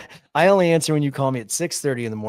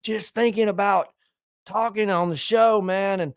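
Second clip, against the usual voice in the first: put-on higher, slow drunk rambling; mocking a friend's drunk dawn call, higher and slow; drunk buddy; 7 semitones higher than usual. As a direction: lazily ramble like a drunk pal. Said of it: "And you're like".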